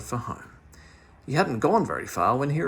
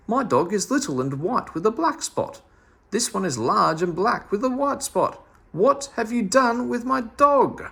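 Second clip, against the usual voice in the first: indignant owner; 9 semitones higher, 4 dB louder than usual. Acted. Miss Tubby protests wrong dog.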